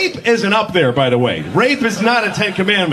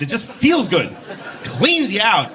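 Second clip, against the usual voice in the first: usual speaking voice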